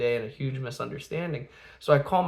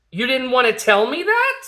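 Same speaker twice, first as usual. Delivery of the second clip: high, loud mock outrage; indignant; 10 semitones higher, 7 dB louder than usual. Eldest confronting little sister, high loud indignation. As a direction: imitate an outraged kid, voice shooting up.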